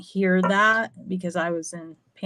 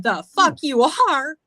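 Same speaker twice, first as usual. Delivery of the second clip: high, louder defiant snap; defiant retort; 7 semitones higher, 5 dB louder than usual. Retold or imitated. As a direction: throw his threat right back at him. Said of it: "I said".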